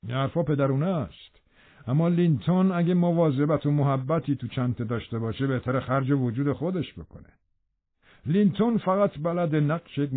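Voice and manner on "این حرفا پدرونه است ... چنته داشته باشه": plain reading voice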